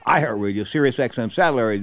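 ordinary talk

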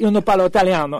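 put-on retelling himself protesting in Italian accent; decent Italian accent. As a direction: plead in convincingly accented Italian. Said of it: "with, like, a decent accent".

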